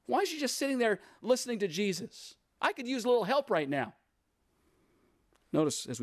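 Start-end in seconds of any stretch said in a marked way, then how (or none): 0.1-3.9 high-pitched, acted exasperated whine of complaint; upset. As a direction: play the upset sister, pitched way up. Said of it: "She's upset".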